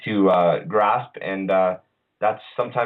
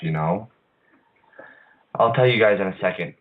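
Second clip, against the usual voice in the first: normal talking voice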